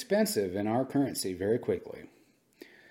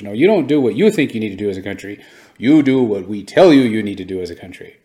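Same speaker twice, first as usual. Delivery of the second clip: louder, fast and scolding, like a bossy lecturer; bossy mock US voice; 10 dB louder than usual. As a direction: hammer it in like you're laying down the law. Voicing the US lecturing nations.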